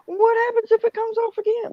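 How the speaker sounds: high, anxious voice; frightened question. Retold or imitated. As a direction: ask it high and scared. Carol frightened of the wheel again.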